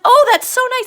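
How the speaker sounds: loud, high burst of acted delight; delighted exclamation. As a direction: gush with childlike pleasure, loudly. Lenny delighted stroking the hair.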